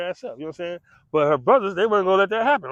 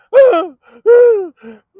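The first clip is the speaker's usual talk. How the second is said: loud mock laugh; 15 dB louder than usual. Imitates brother's reaction.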